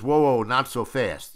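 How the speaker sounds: imitated director stopping the crew; calling a halt. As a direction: rein them in with drawn-out whoas. Director halts stagehands, acted out.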